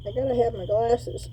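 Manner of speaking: talking through a respirator mask; muffled behind a mask. Real, not acted. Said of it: "in this mask".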